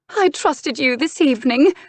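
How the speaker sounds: high, loud, hurt duchess; upset, high-voiced accusation; wounded reproach. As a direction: reproach your brother, pitched right up. Tearful duchess reproaches brother.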